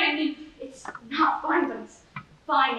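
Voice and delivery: ordinary delivery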